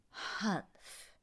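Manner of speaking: breathy unvoiced camel huff; whispered camel humph. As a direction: exhale the humph sulkily.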